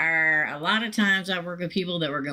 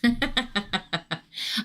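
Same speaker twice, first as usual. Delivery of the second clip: short breathy giggle; a little laugh slipping out; 3 semitones higher than usual. Real, not acted. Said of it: "I don't mean to laugh".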